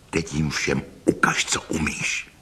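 hushed whisper into an ear. A narrator's reading. Renaud whispers into his horse Bejard's ear, whispering. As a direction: whisper it low, urging on a secret ally. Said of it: "zašeptal Bejardovi do ucha".

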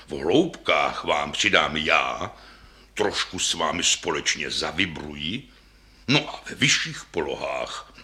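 low, unhurried offer; helpful deep bass. A narrator's reading. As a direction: propose a partnership in a deep voice. Bass offers help in low notes.

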